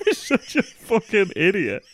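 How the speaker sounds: slow, laughing teasing jab; laughing insult. Real, not acted. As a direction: drag it out through laughter.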